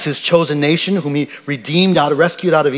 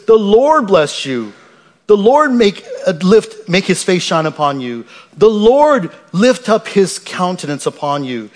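slow, raised pronouncing of a blessing; solemn scripture reading; 4 semitones higher than usual. Acted. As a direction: pronounce it over the people slowly. Reads the priestly blessing.

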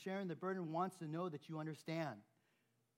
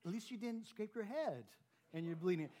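mock brush-off; 6 semitones higher, 4 dB louder than usual. Shown as a demonstration. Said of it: "so, you know".

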